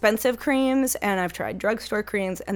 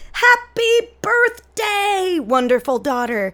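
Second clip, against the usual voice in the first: high, slow sing-song greeting; gushing mom; 9 semitones higher, 5 dB louder, much slower than usual. Retold or imitated. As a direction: read it like a doting mother. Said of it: "she sent this one out on my birthday".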